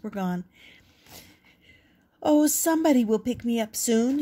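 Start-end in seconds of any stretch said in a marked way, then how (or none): 2.2-4.2 higher, louder, hopeful Victor. A narrator's reading. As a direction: say it out loud, sure of himself. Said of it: "he said out loud".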